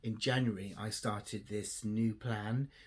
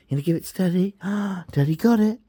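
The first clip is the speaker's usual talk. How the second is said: very high, loud, gasping with glee; egging the dog on in play; excited doggy voice; 12 semitones higher, 12 dB louder, faster than usual. Real, not acted. Urging her dog to bring the toy to Daddy, high excited voice.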